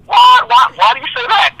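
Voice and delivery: high, startled retold question; taken aback. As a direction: blurt a loud high "what", then ask why. Acting out his startled reply to clerk.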